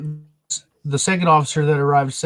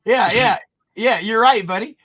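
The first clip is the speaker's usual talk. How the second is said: excited; 7 semitones higher, 5 dB louder than usual. Real, not acted. Cheering the suspect's comeback.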